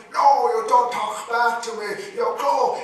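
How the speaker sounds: loud, high, commanding yell; father yelling at his daughter to obey; angry father. Acted. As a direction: shut her down, no arguments.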